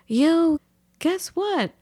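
high-pitched retold announcement; calling out news. Homeowner telling the neighbor the quote, calling out.

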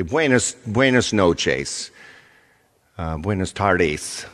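put-on slow, stretched Spanish greeting in a broken accent. Outsourced agent greeting a Spanish caller, broken-accent Spanish. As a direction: labour over the Spanish with a clumsy foreign accent. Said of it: "they speak with complete broken accent".

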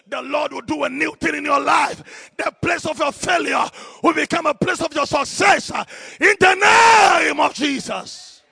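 loud, high declaration building to a drawn-out climax; preacher's shouted prayer crescendo; full-force preaching. Real, not acted. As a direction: thunder the blessing over the congregation.

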